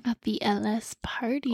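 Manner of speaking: breathy whispered teaser; whispering. Real, not acted. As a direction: whisper it like a secret.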